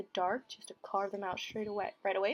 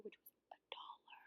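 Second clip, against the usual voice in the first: whispered aside; 16 dB quieter than usual. Real, not acted. Aside about cheap highlighter.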